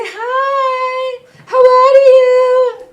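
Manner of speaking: very high, slow sing-song greeting; hammed-up cooing at a camel; mock tourist greeting. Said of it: "they say".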